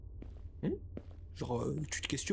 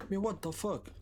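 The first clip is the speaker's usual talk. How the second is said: baffled outburst; 8 semitones higher than usual. Real, not acted. Can't find the last trash item.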